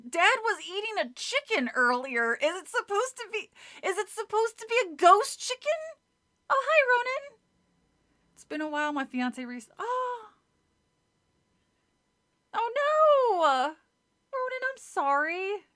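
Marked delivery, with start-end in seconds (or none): 0.1-7.4 high, loud excited chatter; bubbly, thrilled to see him; excited squeal. Real, not acted. Excited reaction to chat. 9.8-15.6 high, loud dismayed gasp; suddenly stricken for a friend; shocked sympathy. Real, not acted. Sudden sympathy for viewer's loss.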